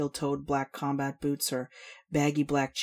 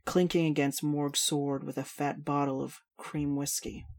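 ordinary delivery

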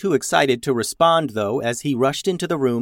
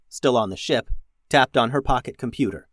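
ordinary delivery, nothing marked

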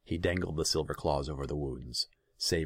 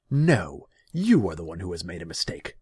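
loud outburst starting high; defiant raised voice; angry retort; 3 semitones higher, 10 dB louder than usual. A narrator's reading. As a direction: hurl the blame back forcefully. Ruler retorts loudly.

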